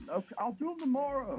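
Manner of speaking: high-pitched henpecked giving-in; mock submissive husband. Mocked husband answering wife about chores, comically submissive. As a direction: give in meekly, exaggerating the high pitch.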